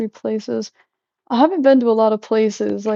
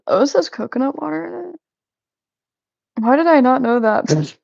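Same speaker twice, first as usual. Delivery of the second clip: surprised; 3 semitones higher than usual. Real, not acted. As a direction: react to an unexpected find on the label. Reads drink label, surprised discovery.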